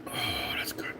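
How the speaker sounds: a hushed whisper after the sip; whispered relish. Real, not acted. Tasting the beer after toasting the viewers, whispered. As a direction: savour it under the breath.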